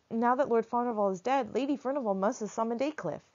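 put-on high, eager boy voice pouring out news; boy breathlessly telling his protector everything; rushing boy. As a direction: tumble the words out in a rush. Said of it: "I went on in a rush".

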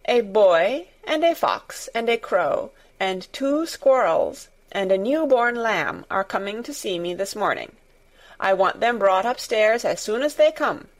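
plain reading voice

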